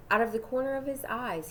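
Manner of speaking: usual speaking voice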